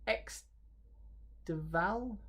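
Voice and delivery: hesitant "X ... Deval?", broken by a long pause; "Deval" tried in two syllables, de-VAL, unsure; struggling pronunciation. Real, not acted. Presenter struggles with the bike's name, halting pronunciation. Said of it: "one I'm gonna struggle to pronounce".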